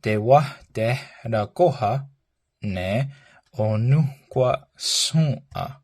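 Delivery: slow, careful model of the phrase. Shown as a demonstration. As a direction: say it slowly for learners to copy. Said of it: "One more time".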